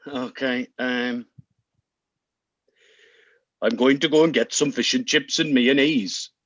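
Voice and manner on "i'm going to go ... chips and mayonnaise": low-pitched attempt at a Welsh accent. Player delivering the game's set line, Welsh accent. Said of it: "in Welsh".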